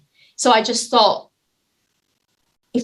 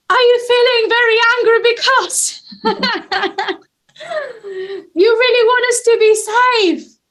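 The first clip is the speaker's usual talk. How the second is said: much higher, louder, bright-eyed guess; playful, energetic empathy; 13 semitones higher, 4 dB louder than usual. Retold or imitated. Retelling her empathic guess to the angry man, high and loud. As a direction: meet his anger with big playful energy. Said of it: "very much in my body and kind of playful".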